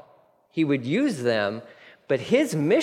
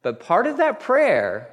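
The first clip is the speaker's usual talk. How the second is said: ordinary talk, nothing marked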